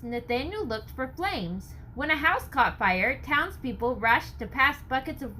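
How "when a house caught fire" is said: ordinary delivery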